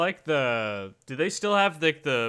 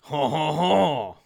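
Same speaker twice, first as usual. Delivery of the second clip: ordinary talk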